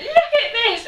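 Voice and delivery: high, loud, laughing through it; laughing exclaim. Retold or imitated. Friend showing off the handprint, laughing. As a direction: squeal it out between laughs.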